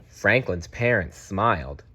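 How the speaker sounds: ordinary delivery, nothing marked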